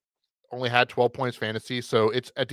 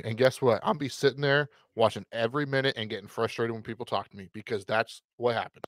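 usual speaking voice

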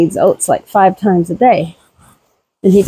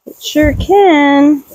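slow mock gotcha; 7 semitones higher, much slower than usual. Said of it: "And you're like".

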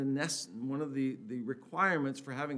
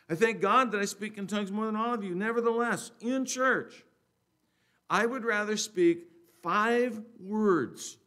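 loud, raised, emphatic Bible reading; scripture reading; 4 semitones higher, 6 dB louder than usual. Acted. Preacher reading scripture to the congregation, raised and emphatic. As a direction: proclaim the verse with deliberate pauses.

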